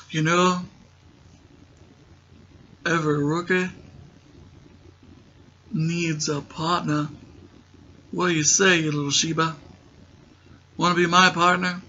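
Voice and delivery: attempted Australian accent, broken up with pauses; a hint of a botched Aussie pick-up line; bad Australian accent. [name] hitting on Amy, bad Australian accent. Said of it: "I still can't do an Australian accent".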